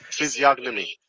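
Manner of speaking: "Physiognomy" with a hard g before the n; G pronounced. Shown as a demonstration. Host copying the recording, G pronounced. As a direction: say "Physiognomy" sounding the G. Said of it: "He's pronouncing the G".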